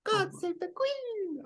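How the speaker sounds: squeaky high anime singer voice; high cute anime girl. Retold or imitated. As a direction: sing it like a cutesy anime idol. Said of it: "the punk version in an anime voice".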